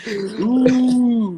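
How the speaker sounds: high long delighted ooh; excited squeal. Real, not acted. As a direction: squeal it out loud. Squealed reaction.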